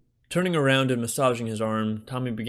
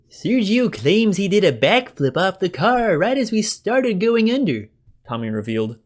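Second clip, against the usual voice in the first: put-on excitable young guy; 8 semitones higher, 7 dB louder than usual. A narrator's reading. Tommy voiced.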